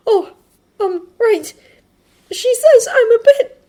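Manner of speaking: high, halting character voice; hesitate, then resume in a high voice; flustered Midoriya. Acted. Midoriya refocusing after being interrupted, flustered.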